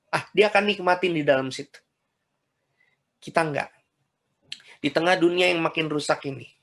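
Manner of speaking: ordinary delivery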